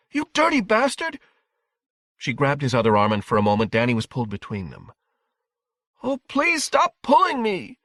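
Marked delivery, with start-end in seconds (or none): 0.1-1.2 very high furious shriek; outraged cry. A narrator's reading. Wife cursing the husband who slapped their boy, shrieking. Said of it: "Wendy cried". 6.0-7.8 put-on high, slow, drawn-out child's wail; agonized scream. A narrator's reading. Boy pulled between his parents, screaming in agony. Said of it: "he screamed at them".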